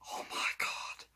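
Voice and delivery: whispered, stunned reaction; awed whisper. Retold or imitated. Fan retelling own reaction to the finished transfer, awed whisper. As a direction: breathe it out under your breath in awe.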